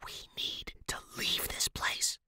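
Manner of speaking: urgent breathy whisper into an ear; hissed whisper. Acted. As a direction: whisper it so no one else hears. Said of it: "I hissed into Megan's ear".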